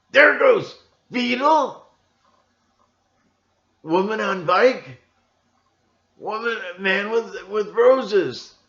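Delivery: high, loud, slow call of each passing extra; mock announcer. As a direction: announce the staged passersby like a ringmaster.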